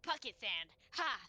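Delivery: loud, punchy battle call; playful attack cry. Acted. Player throwing sand at an opponent, loud playful cry. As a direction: shout it as a mock surprise attack.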